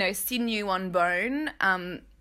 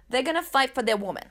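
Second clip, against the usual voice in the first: quicker, playful try at New York Italian-American; attempted New York Italian accent; 3 semitones higher, 3 dB louder, faster than usual. Host describing men who fight for their woman, New York Italian accent. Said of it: "This accent is so good".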